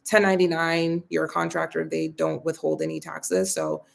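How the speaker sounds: ordinary talk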